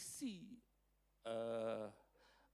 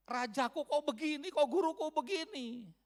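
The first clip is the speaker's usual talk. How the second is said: high, loud cry of dismay; loud, wounded complaint, acted out; disappointed disciple; 11 semitones higher, 9 dB louder than usual. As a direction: protest in let-down disbelief, pitched right up. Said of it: "dia dikecewakan".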